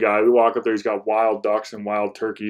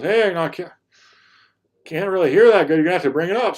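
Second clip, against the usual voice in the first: put-on high, loud old man's voice; hard-of-hearing old farmer; 8 semitones higher, 5 dB louder than usual. Old landowner telling the hunter he can't hear, high loud imitation. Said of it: "he's probably a hundred thirty".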